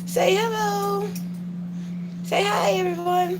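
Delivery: put-on high sing-song voice for the baby; high cooing baby talk. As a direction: coo at the little one to say hi. Voicing for baby.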